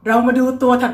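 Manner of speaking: ordinary delivery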